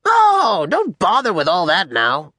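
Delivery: high-pitched, dismissive lord; Alexander. Acted. General to a kneeling soldier, high-pitched and dismissive. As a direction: start very high and wave it away. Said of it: "He has a high-pitched voice".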